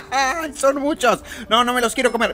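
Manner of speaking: high anxious cries; playful panic. Acted. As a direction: squeal in mock panic. Rodeado de muñecos. (pánico de broma agudo)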